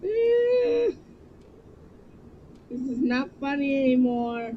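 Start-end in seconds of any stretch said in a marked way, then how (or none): 0.0-0.9 high, loud whine of alarm; drawn-out high "eh"; frightened squeal. Real, not acted. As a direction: let out a high scared squeal. Frightened noise in game.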